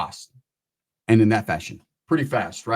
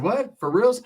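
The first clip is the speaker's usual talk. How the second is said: high rising disbelief; surprised; 8 semitones higher, slower than usual. Retold or imitated. Teller told he gets a cut, high and surprised. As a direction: squeak it, can't believe it.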